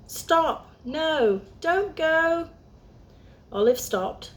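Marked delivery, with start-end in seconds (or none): 0.1-2.5 urgent warning call. Acted. A squirrel calls out to stop Olive, high urgent shout.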